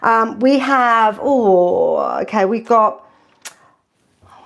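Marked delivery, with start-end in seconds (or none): none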